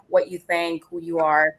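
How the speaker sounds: usual speaking voice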